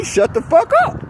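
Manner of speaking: high mock yell at him; imitating her shout. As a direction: snap it like the angry stranger. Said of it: "She told me".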